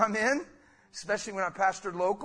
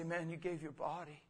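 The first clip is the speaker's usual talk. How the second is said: soft thankful prayer; hushed prayer of thanks at communion; quiet prayer; 3 semitones lower, 12 dB quieter than usual. Shown as a demonstration. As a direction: whisper thanks over the bread. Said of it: "hold up the bread and say".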